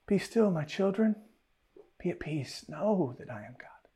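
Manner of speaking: put-on soft hushed "Be still, my children" fading to a whisper; tender hush to children; gentle whisper. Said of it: "we think he says".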